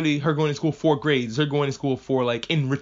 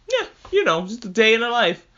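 sarcastic; 6 semitones higher than usual. Real, not acted. Sarcastic shrug at the danger.

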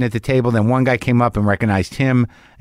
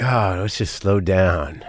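slow, soft and much lower, dragging; lazy slow-down; 5 semitones lower, 4 dB quieter, much slower than usual. Retold or imitated. Host telling his guest how heat makes him feel, slow sleepy drawl. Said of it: "I'm more like, sort of like".

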